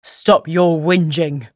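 slow, menacing thug warning; a hint of a threatening brute; menacing low voice. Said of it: "so much menace in his low voice".

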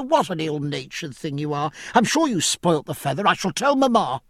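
put-on high, quick, indignant girl; a spoiled young lady taking offence; Cecilia. Cecilia again.

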